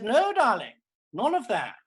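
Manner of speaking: high, loud scolding no; loud exasperated no. Retold or imitated. To Mother asking about Mrs Brown's Boys, loud high scolding no. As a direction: shut her down, loud and high.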